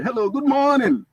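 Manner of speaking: high, drawn-out mock greeting; sarcastic sing-out hello. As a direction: stretch it out high with heavy sarcasm. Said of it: "Sarcastically, we are telling you".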